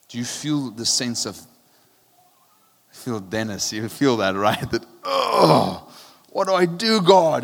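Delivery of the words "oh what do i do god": anguished cry, higher and louder; a lonely old man pleading with heaven; crying out to God. Retold or imitated. Exiled John crying out to God in anguish. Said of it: "And he's crying out".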